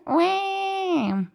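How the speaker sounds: put-on high twangy baby wail; one long, high wah like a crying baby. Shown as a demonstration. Singing teacher demonstrating twang, mock baby cry. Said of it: "Can you give me a baby crying?".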